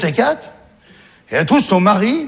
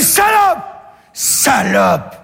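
high-pitched outraged tirade; acted furious yelling; 8 semitones higher than usual. Wife finding her sister in the wardrobe, furious. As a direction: explode at her, shouting.

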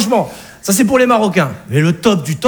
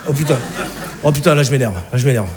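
ordinary talk, nothing marked

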